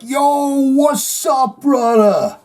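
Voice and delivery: high, bright-eyed hey brother; eager greeting. Retold or imitated. Fan greets [name] at club, high eager shout. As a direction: call out to a famous drummer like an old pal.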